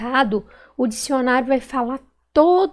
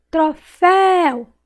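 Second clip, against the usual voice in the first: "Troféu" slow and loud, high long FÉU; stretched stressed syllable; 5 semitones higher, 5 dB louder, much slower than usual. Shown as a demonstration. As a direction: call out "Troféu", holding FÉU. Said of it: "Qual que eu demorei mais falar".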